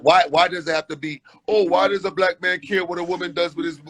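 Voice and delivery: usual speaking voice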